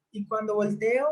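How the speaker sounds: normal talking voice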